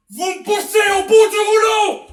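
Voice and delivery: screaming high and very loud; furious scream. Acted. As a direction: scream it at him in fury. Cornered writer screams at captor.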